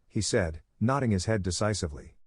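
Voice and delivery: plain reading voice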